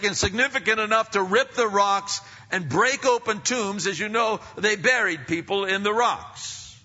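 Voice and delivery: high, loud, driving preacher's cry; full-force preaching. Acted. Preacher thundering to the congregation about creation shaking.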